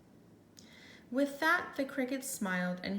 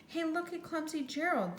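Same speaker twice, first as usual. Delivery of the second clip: higher mocking jeer at Gerald; sneering; 7 semitones higher than usual. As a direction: taunt him like schoolyard bullies. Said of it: "the animals all sneered".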